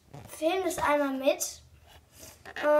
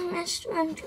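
ordinary talk, nothing marked

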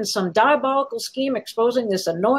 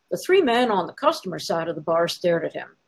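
ordinary delivery, nothing marked

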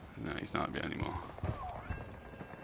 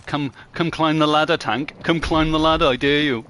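loud, higher mocking taunt; 6 semitones higher, 8 dB louder than usual. Real, not acted. Gamer taunting an enemy tank player, loud and mocking. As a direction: goad the tank player up the ladder.

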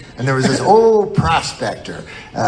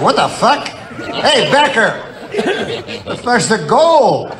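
put-on indignant kids; 8 semitones higher, slower than usual. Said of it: "they're like".

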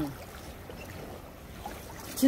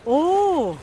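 surprised; 5 semitones higher, 7 dB louder than usual. Real, not acted. Sees net full of fish.

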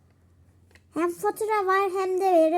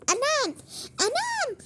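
alarmed; 10 semitones higher than usual. Pretend. Dishes falling, alarmed cry.

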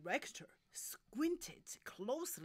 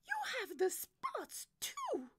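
high, loud diva voice; dismayed soprano; 6 dB louder than usual. Acted. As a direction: exclaim like an alarmed diva.

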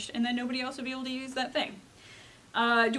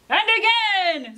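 higher, louder, stretched-out repeat; excited, escalating narration; 8 semitones higher, 9 dB louder, slower than usual. A narrator's reading. Llama keeps pressing the button, escalating storyteller cry. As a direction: draw it out high and loud as the chaos grows.

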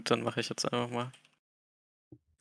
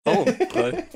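excited; 7 dB louder than usual. Real, not acted. Excited reaction.

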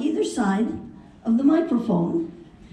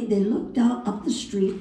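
ordinary talk

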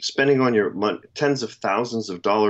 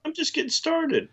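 mock indignant; 4 dB quieter than usual. Mock protest at wrap-up.

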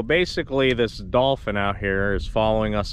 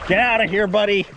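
shooing yell; 10 semitones higher, 4 dB louder than usual. Real, not acted. Shooing dolphin away.

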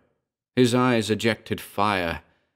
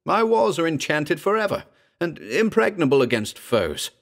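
desperate insistence; 9 semitones higher than usual. A narrator's reading. Gurov insists walls are enchanted.

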